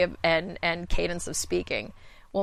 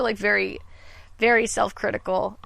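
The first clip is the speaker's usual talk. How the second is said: normal talking voice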